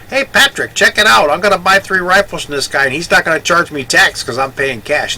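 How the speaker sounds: loud, high call across three aisles; yelling across the store. Retold or imitated. Walter calls to Patrick across the gun store, loud and high. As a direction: shout it to a friend aisles away. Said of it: "Walter kinda yells across from three aisles over".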